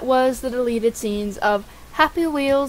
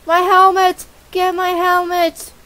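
high, raised cry for the helmet; mock panic; 6 semitones higher, 6 dB louder than usual. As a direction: call out in hammed-up alarm. Character loses helmet in game.

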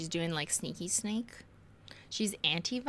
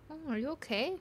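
high, soft pet-talk; fussing over a cat; 7 semitones higher, 4 dB quieter, faster than usual. Real, not acted. Owner asking the cat if it's okay, fussing.

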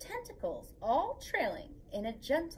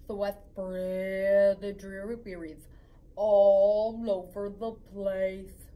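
lower, droning mopey fish voice, slow at the end; glum pout-pout fish; 5 semitones lower, 5 dB louder, slower than usual. Acted. Reading the fish's refrain to kids, glum and droning. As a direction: sulk through it like a gloomy fish.

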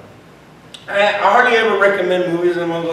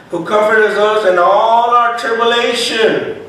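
loud, raised preacher's voice; scripture reading; 3 semitones higher, 6 dB louder than usual. Acted. Preacher reading on comfort in tribulation, loud scripture reading. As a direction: read the verse out forcefully.

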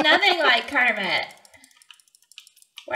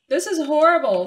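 raised pitch; louder, exasperated; 4 semitones higher, 8 dB louder than usual. Real, not acted. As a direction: exclaim it with dismay. Louder repeat.

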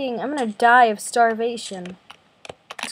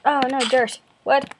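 usual speaking voice

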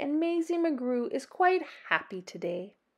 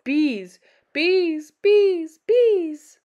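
each bees louder and higher, ending in a shout; building bees; 6 semitones higher, 7 dB louder, much slower than usual. A narrator's reading. Narrator building the swarm, rising to a shout. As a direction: let the bees swell from firm to a cry.

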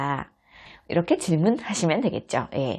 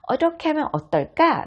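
gentle suggestion; 10 semitones higher than usual. Shown as a demonstration. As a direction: suggest it gently, like coaxing a child. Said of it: "부드럽게 제안하는 표현".

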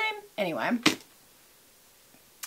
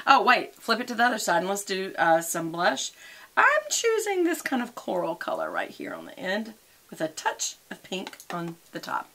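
ordinary delivery

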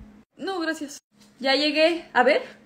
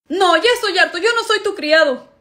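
fed-up complaint; 5 semitones higher, 4 dB louder than usual. Acted. Hermano harto se queja.

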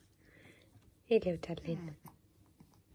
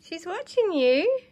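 put-on sing-song baby talk; 7 semitones higher, 7 dB louder, slower than usual. Shown as a demonstration. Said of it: "repeated with more lift".

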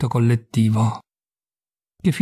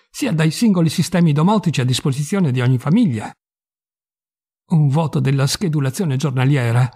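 ordinary delivery, nothing marked

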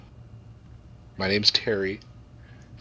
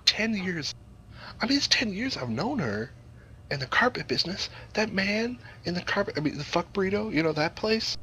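higher, worked-up disbelief; indignant rant; 10 semitones higher than usual. Pretend. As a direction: rant about the betrayal. Outraged at the betrayal.

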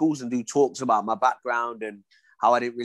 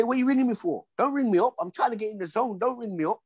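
higher, mock-irritated retelling of his own snapping; irritated brush-off; 7 semitones higher than usual. To his wife ringing the hotel before a fight, mock-irritated and higher. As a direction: push the caller away, impatient and pitched up.

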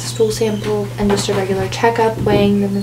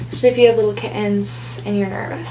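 normal talking voice

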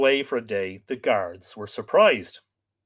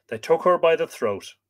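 ordinary delivery

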